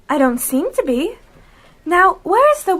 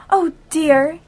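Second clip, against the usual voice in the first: ordinary talk, nothing marked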